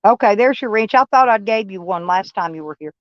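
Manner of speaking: ordinary delivery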